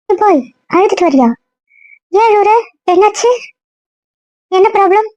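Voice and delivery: high-pitched, startled concern; alarmed questioning. Acted. Friend questions someone who comes back crying, high and alarmed. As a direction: pitch it way up, urgently asking.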